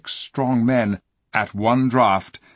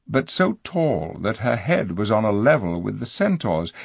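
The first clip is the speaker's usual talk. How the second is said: plain reading voice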